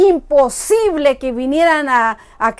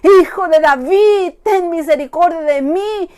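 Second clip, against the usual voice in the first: desperate crying plea; 4 semitones higher, 5 dB louder, slower than usual. Acted. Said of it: "cuántas veces gritaba".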